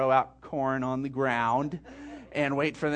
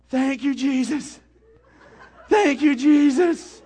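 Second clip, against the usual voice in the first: tearful, laughing thanks, higher and louder; choked-up joy, thanking Jesus; crying thanks; 9 semitones higher, 9 dB louder than usual. Retold or imitated. Boy thanks Jesus after his first deer, tearful and loud. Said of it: "sitting there crying, and he goes".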